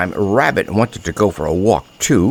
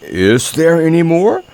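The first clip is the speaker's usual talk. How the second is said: higher, louder bear voice; quick, eager Pooh; 7 semitones higher, 4 dB louder than usual. A narrator's reading. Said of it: "asked Pooh quickly".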